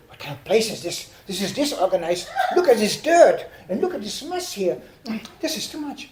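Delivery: mock fussy tourist complaint, climbing in pitch; nervous German tourist. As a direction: act out a German tourist getting worked up over mess. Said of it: "They are so nervous".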